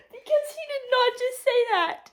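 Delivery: high, loud shocked squeal at the page; outraged disbelief. Real, not acted. Reader shrieking at what Sam just said. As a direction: shriek it like you can't believe it. Reacting to a shocking line in book.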